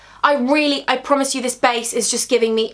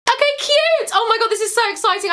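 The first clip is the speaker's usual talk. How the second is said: excited squeal; 8 semitones higher than usual. Real, not acted. Bursting back after song game.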